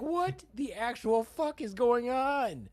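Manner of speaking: slow high stunned disbelief; shocked disbelief. Retold or imitated. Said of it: "I was like".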